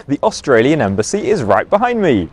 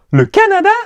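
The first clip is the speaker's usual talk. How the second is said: Le Canada in a much higher squeak; tiny squeaky Le Canada; 4 dB louder, much faster than usual. Shown as a demonstration. Repeated drill, varied takes.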